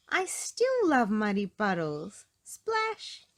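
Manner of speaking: loud, slow, gleeful; cheering. A narrator's reading. Peppa cheers jumping into a puddle. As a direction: cheer and leap in. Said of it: "Peppa cheered".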